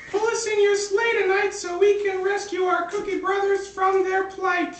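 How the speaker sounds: high squeaky cookie voice; high cartoon cookie voice; cookie twins. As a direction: make demands as tiny cookies. Cookie twins puppets speak.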